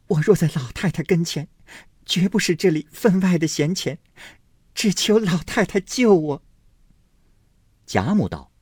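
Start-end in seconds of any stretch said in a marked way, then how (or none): 0.1-6.4 put-on high, tearful young girl's voice; tearful plea. A narrator's reading. Daiyu pleads through tears with grandmother, high tearful voice. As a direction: cry out in disbelief. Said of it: "黛玉哭道".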